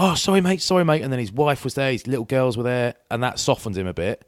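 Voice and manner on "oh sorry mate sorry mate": high, loud mock sorry mate; mock apology. The bloke apologising after grabbing his hand, mock voice. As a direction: imitate the bloke backing off.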